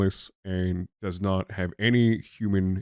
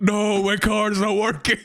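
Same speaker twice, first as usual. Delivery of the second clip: high, slow, whiny panic; mock distress over the card; panicked shopper; 9 semitones higher, slower than usual.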